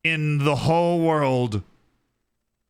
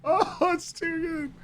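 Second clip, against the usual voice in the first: still laughing; 12 semitones higher, 4 dB quieter than usual. Real, not acted. After laughing fit.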